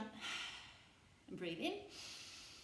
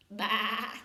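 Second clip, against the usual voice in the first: sheep baa; 5 dB louder than usual. Shown as a demonstration. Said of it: "It's a sheep".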